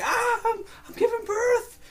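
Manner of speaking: high-pitched, loud imitated birth scream; hammed-up screaming in childbirth; mock scream in pain. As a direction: wail it out high like acted labor pains. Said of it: "she's screaming in pain".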